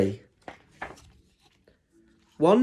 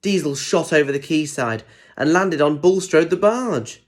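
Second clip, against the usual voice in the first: ordinary delivery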